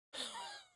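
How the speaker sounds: short, very high laugh. Real, not acted. Host amused by the Ebola interview story, laughing. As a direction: burst briefly into a high laugh. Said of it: "I'm laughing because of the whole".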